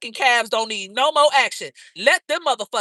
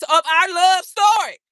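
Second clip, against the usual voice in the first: drawn-out shout; 7 semitones higher, 6 dB louder, slower than usual. Real, not acted. Hyping her love story intro.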